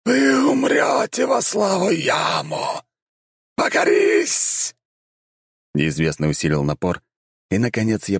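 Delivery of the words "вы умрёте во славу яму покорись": high, slow, otherworldly vampire drawl; a voice from beyond the grave; sepulchral voice. A narrator's reading. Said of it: "всё тем же замогильным голосом".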